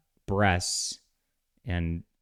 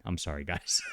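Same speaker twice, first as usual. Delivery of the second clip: usual speaking voice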